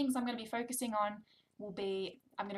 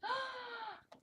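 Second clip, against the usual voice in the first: high exaggerated gasp; big mock gasp; 6 dB quieter than usual. Shown as a demonstration. Reading to toddler, hammed-up gasp. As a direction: gasp as if amazed.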